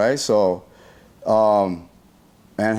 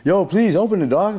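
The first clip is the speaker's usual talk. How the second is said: higher-pitched pleading call; retold plea, pitched up; acted urgent plea; 8 semitones higher than usual. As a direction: plead to be let in. Acting out desperate plea at doors.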